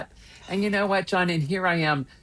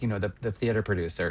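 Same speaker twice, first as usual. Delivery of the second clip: normal talking voice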